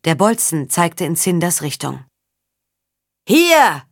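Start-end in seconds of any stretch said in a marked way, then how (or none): 3.3-3.8 loud, high call; bellowed shout. A narrator's reading. Warrior with the sword alerts his men, bellowing. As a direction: yell it out to the others. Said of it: "brüllte der mit dem Schwert".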